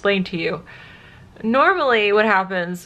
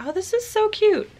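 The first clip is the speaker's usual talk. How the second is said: gushing; 13 semitones higher than usual. Real, not acted. Gushing over a dress.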